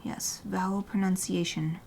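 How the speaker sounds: ordinary talk, nothing marked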